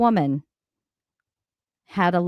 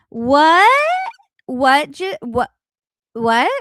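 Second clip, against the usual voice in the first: sharp, loud confused what; baffled what; 4 semitones higher, 5 dB louder, slower than usual. Real, not acted. Reacting to the clip in loud disbelief.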